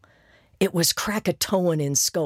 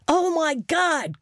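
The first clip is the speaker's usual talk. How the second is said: groaning; 13 semitones higher, slower than usual. Acted. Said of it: "Crystal groaned".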